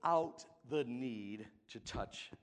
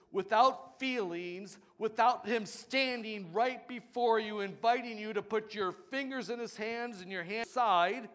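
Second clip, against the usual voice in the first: loud, higher preaching build; full-force preaching; 9 semitones higher, 9 dB louder than usual. Acted. Preacher driving the warning home to the congregation, loud. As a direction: preach it to the rafters.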